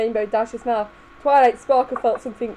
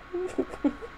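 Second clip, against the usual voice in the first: soft laugh; 7 semitones higher, 11 dB quieter than usual. Real, not acted. Soft laugh after line.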